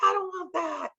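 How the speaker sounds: high whiny refusal; bratty kid. Retold or imitated. Daughter refusing mom's food, bratty whine. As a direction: pout like a spoiled child. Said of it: "being a brat".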